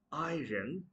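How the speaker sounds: iron as "eye-run"; mispronounced iron. Shown as a demonstration. Teacher warning learners, iron wrongly as "eye-run". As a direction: sound the R in the middle of iron. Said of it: "That's not iron".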